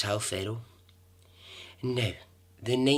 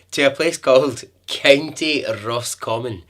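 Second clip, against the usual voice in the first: hammed-up Irish accent, louder; 3 semitones higher, 10 dB louder than usual. Host naming the county in a loud mock Irish accent. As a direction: keep up the mock Irish lilt. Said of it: "This is my Irish accent".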